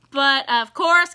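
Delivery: ordinary delivery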